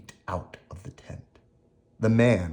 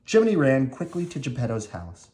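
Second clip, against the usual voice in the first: ordinary delivery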